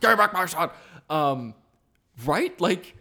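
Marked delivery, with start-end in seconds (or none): 0.0-0.7 loud echo of a hammed-up demand; mock demanding yell. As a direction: shout it like an overdramatic parent.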